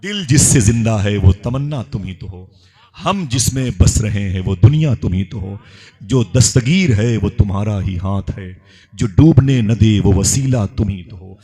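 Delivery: full-voiced, measured poetry recitation; devotional verse. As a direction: declaim each line in praise.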